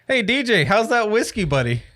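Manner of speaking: teasing shout to DJ; playful call-out. Real, not acted. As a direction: hail a buddy across the room. Calling out teasingly to friend.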